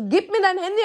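high, insistent demand. Retold or imitated. Pressing her friend for his phone, high and insistent. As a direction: push him hard for the phone. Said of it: "Ich so".

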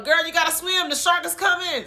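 high, loud urgent yell; yelling warning. Retold or imitated. Cody yelling at the girl to swim, loud urgent warning. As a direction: shout at her to swim. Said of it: "Cody yelling".

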